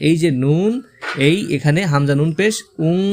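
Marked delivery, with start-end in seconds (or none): 2.9-3.0 উং hummed through the nose, ending in ng; nasal anusvara sound. Shown as a demonstration. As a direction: let উং ring as a held nasal. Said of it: "বাংলার অনিস্বরের মতো উচ্চারণ করতে হবে".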